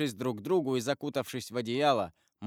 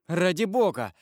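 higher-pitched, bewildered plea; frantic appeal, raised in pitch; distraught exclamation; 5 semitones higher, 4 dB louder than usual. A narrator's reading. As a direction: exclaim as a cornered, lost man. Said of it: "воскликнул он, бледный, растерянный".

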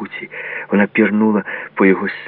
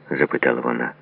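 ordinary delivery

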